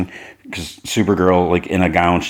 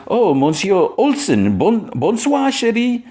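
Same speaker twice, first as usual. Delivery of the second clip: slow, much higher mock French accent; French princess; 14 semitones higher, slower than usual. Princess greets Jimmy on the carpet, mock French accent. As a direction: purr it in a hammed-up French accent. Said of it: "she's like".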